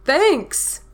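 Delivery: high, bright storybook exclamation; exclaimed thanks. A narrator's reading. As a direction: sing out thanks as the bear. Bear thanks his friend.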